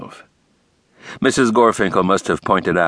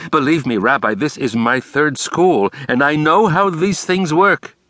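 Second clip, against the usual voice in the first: higher, fussy theatrical principal; Brooks's voice; 7 semitones higher than usual. A narrator's reading. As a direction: lay it out with flair. Brooks advising on politics.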